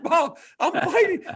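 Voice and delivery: high, loud, drawn-out protest; protesting yell. Retold or imitated. Fighter pleading with coach Bob, high mock yell. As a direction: cry out in mock indignation.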